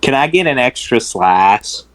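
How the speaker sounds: slow drawling country voice; country accent. Retold or imitated. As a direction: ask it like an old country boy. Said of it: "that's a good old country voice".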